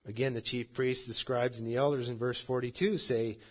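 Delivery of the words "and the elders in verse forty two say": ordinary talk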